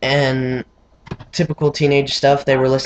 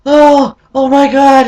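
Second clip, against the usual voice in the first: much higher, louder "oh my God" in pain; pained cry; 11 semitones higher, 7 dB louder, slower than usual. Retold or imitated. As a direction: yelp it out as the arm snaps. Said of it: "I was like".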